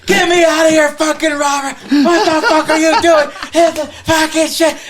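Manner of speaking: high, loud frantic screaming; shrieked retold alarm, far above normal pitch; acted panicked yelling. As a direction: scream it in hammed-up panic, close to laughing. Acts startled man being grabbed.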